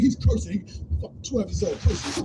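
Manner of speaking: ordinary talk, nothing marked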